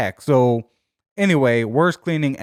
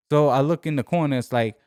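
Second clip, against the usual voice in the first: ordinary talk, nothing marked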